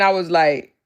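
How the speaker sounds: usual speaking voice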